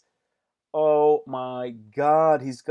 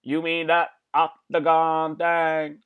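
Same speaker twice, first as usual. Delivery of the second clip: mock innocent voice; 3 semitones higher, 4 dB louder, slower than usual. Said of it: "And then he goes".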